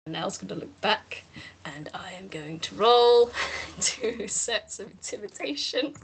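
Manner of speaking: hushed, laughing aside; whispered game-master aside. Real, not acted. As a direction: whisper the dice roll to the table. Whispered aside about the roll.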